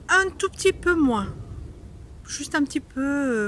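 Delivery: slightly annoyed. Shown as a demonstration. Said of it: "Un peu énervé".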